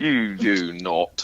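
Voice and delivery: slow, low put-on Aussie-like accent; Aussie-sounding accent. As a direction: draw it out softly in a mock Australian accent. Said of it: "That sounds more Aussie".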